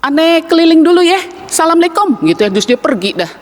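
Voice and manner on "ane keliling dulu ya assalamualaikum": high, easygoing Betawi peddler voice; Betawi vendor. Retold or imitated. Vendor misunderstanding taraje and leaving, high and casual. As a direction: breezily wave him off and walk on. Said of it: "si orang Betawi ini katakan".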